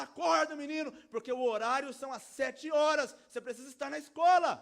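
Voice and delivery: loud, high preacher's tirade; heated full-volume rousing; carried-away shouting. Acted. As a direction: shout like a father hauling a child out of bed. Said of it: "Eu me empolguei".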